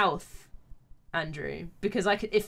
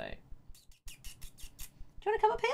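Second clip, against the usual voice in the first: high pet-talk question; coaxing a pet; 14 semitones higher, 6 dB quieter than usual. Real, not acted. Streamer asks the cat to come up, high coaxing voice. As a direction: lure the cat in a much higher voice.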